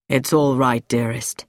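low-pitched male character voice; winded reassurance. A narrator's reading. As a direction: reassure a loved one in deep tones. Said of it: "He was breathing hard".

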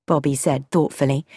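ordinary delivery

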